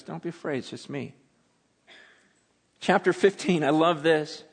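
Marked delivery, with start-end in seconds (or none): 0.0-1.1 reassuring Jesus. Acted. Jesus calms terrified disciples.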